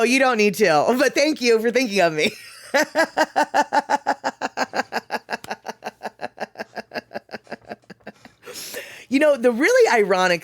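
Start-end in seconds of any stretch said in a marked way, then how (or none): 2.7-7.7 very long giggle tailing away; real laughter. Real, not acted. Streamer chatting with viewers about bags, long laughter. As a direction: keep laughing until it slowly runs out.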